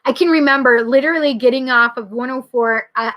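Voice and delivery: usual speaking voice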